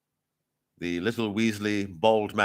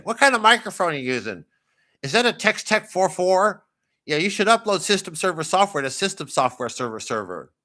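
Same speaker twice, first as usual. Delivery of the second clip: higher, louder, quick-fire nerdy heckler; live chat pest; 8 semitones higher, 4 dB louder than usual. Acted. Live chat commenter quizzing the streamer about gear, pestering voice. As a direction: pester the streamer in a raised geeky voice. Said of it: "somebody to pop up and say".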